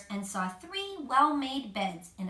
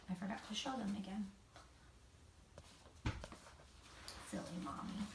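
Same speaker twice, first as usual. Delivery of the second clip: much softer, fast muttered aside; quiet aside; 3 semitones lower, 17 dB quieter, faster than usual. Real, not acted.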